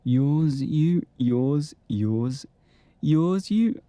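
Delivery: light Scouse lilt on yours, you, rising; Liverpudlian. Acted. Actor drilling the line yours, you in Liverpudlian. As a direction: toy with the Liverpool sound of yours. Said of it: "I've gone Liverpudlian".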